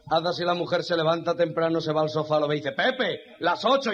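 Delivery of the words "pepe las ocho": wife waking husband. Acted. Wife wakes husband on sofa.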